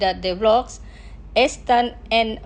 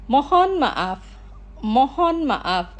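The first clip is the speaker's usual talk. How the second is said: Mohon maaf, slowly, with the stress on Mohon; slow pronunciation; slower than usual. Shown as a demonstration. Teacher models Mohon maaf slowly for the radio audience, slow pronunciation. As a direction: stretch out Mohon maaf for learners. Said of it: "Los pronunciaré lentamente".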